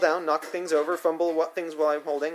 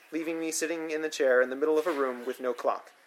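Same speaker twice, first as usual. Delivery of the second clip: usual speaking voice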